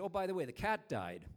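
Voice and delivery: casually tossing out "the cat died", falling flat at the end; blithe. Retold or imitated. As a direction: drop "the cat died" like trivial news, offhand.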